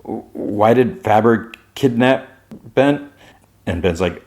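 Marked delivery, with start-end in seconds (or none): 0.0-3.1 rising disbelief. Retold or imitated. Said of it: "John's like".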